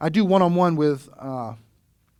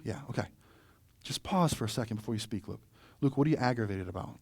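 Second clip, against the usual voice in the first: calm reassuring teacher; 4 semitones lower, 5 dB quieter than usual. Retold or imitated. Teacher calming a student.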